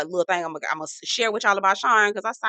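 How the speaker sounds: ordinary talk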